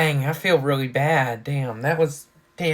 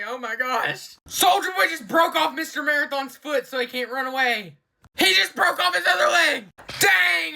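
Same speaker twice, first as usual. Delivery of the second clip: shocked exclamations; 9 semitones higher than usual. Real, not acted. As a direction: gasp it out as the gore unfolds. Gory fight scene shocks viewer.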